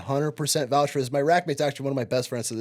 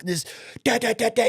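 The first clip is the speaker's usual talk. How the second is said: higher rattling da da da; gun sound imitation; 8 semitones higher than usual. Teen watching the news, mimicking gunfire.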